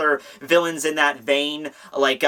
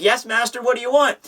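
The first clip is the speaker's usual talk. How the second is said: lightly voiced obedient sidekick, pitched up; eager servant; 6 semitones higher than usual. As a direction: answer your master, higher and ready. Voicing the eager servant James.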